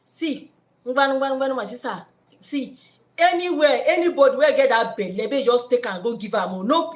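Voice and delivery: a hammed-up Warri pidgin man; Warri pidgin guy. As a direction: brush her off in Warri pidgin. Man refuses responsibility in pidgin.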